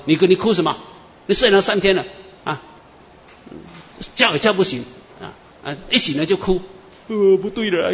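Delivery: husband baffled at his crying wife; husband alarmed. Retold or imitated. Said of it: "他先生说". (丈夫惊慌地问)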